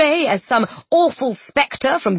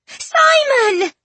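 very high, drawn-out cry of a name; joyful yell; 3 dB louder than usual. A narrator's reading. Children spotting their old friend, joyful high yell. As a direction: yell it in delighted recognition. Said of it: "incorrigibles yelled and ran to hug".

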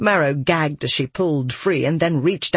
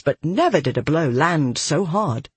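plain reading voice

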